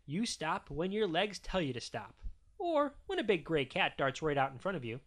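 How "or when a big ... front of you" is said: ordinary delivery, nothing marked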